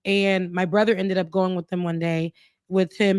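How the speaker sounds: usual speaking voice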